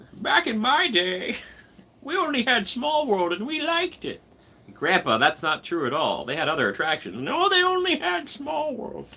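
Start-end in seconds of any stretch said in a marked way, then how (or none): 0.1-4.2 put-on high, slow creaky old man. Shown as a demonstration. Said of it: "Old man voice". 7.2-9.1 put-on high, slow cranky old man. Shown as a demonstration. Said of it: "Old man voice".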